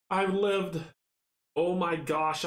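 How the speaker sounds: high and loud, thrilled; excited disbelief. Real, not acted. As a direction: blurt it out, amazed you survived. Surprise at surviving.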